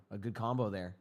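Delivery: ordinary talk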